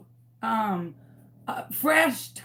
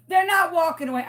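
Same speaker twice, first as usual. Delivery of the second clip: retold yell; 6 semitones higher, 3 dB louder, much faster than usual. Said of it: "I'm screaming at my neighbors".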